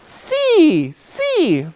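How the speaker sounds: high puppet "See" with clear long S; correct S. Pretend. Puppet repeating the word proudly, high clear "See". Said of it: "Very good".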